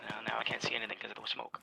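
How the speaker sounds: hushed whisper under cover. Real, not acted. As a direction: keep it to a breathy whisper. Stealthy player in firefight.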